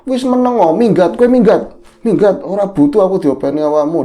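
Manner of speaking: high, loud shout to get out; angry father's shout. Retold or imitated. Father ordering his son out, high loud angry shout. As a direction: chase the son away in a rage.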